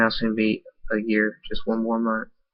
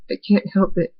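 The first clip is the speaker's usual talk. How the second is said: tearful; 9 semitones higher, 3 dB louder than usual. Acted. Lover weeping at deathbed.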